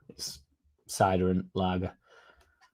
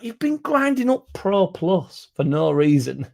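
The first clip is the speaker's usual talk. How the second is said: mocking, slow and high; 8 semitones higher, slower than usual. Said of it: "I'm like".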